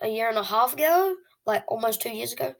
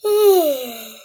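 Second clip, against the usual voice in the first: heavy sigh; 4 semitones higher, 7 dB louder than usual. Real, not acted. Sad about losing subscribers.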